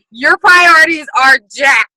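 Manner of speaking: loud, slow exasperated call-out; mock scolding shout. Friends scolding her priorities, loud and slow. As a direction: drag it out loud like friends rolling their eyes. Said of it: "And they were like".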